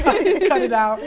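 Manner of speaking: loud, laughing cut it out; laughing protest. Real, not acted. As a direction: laugh while telling them to stop.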